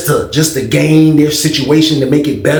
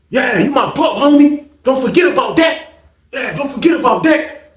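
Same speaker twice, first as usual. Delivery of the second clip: high, drawn-out taunts; retold taunting; 9 semitones higher than usual. BK putting Prince in his place, high taunting. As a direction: needle him in a high, insistent voice. Acted taunt to a junior member.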